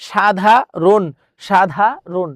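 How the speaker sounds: সাধারণ split "sha-dha... ron", ending on n; final hasanta. Shown as a demonstration. Teacher showing the final hasanta, broken-up সাধারণ. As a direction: pause before the last syllable of সাধারণ, close on bare n. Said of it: "লাস্টে হসন্ত হয়েছে".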